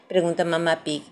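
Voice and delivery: usual speaking voice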